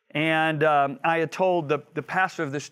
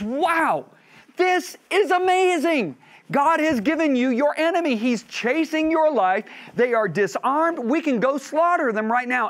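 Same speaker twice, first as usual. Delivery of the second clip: excited urging; 9 semitones higher, 4 dB louder than usual. Acted. David's men urge him on.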